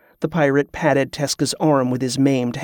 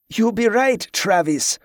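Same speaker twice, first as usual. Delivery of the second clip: higher start, Travis lightly overemphasized; too much emphasis on TRAVIS; 7 semitones higher than usual. A narrator's reading. Giacomo plays along with Tezca, stressing Travis. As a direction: lean on the name Travis. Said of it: "too much emphasis on the name".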